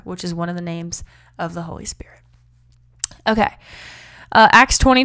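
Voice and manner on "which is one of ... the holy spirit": soft whisper tucked in; whispered aside. Real, not acted. Host whispering a side note to listeners. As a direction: breathe it as a secret.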